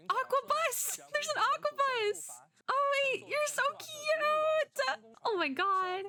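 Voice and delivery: very high, slow squeal of delight; excited squeal. Real, not acted. Streamer spotting the aqua bus, high excited squeal. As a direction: shriek it like you just saw something adorable.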